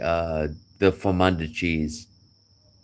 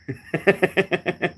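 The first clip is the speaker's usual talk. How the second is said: high laugh escaping; 14 semitones higher than usual. Real, not acted. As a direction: chuckle up high, amused. Laughing at own story.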